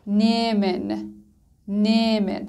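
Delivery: نیمن very slow, first vowel drawn out; long e, silent h. Shown as a demonstration. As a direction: stretch the e in نیمن, no h. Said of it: "ا به صورت کشیده تلفظ شد".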